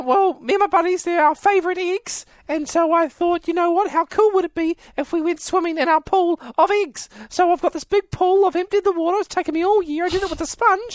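gleeful egg thief dreaming of his egg pool; put-on Paul voice. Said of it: "I went with high and pitch".

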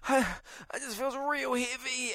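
a feeble groaning voice; weak man. Retold or imitated. Sick man telling promo girl he feels heavy, weak strained. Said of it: "He's like".

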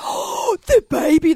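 gasped oh, then loud and high; mock gushing. People ignore mum and greet her baby, gushing. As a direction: swoop in on a baby.

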